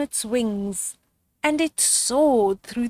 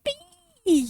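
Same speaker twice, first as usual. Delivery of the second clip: much higher happy yell; yelled yippee. Acted. Little brother mouse cheering Christmas, high yell. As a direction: whoop it up with joy. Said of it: "yelled Daisy's brother".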